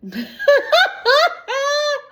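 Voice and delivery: loud high burst of real laughter. Real, not acted. Narrator laughing at his own story. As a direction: crack up at the reading.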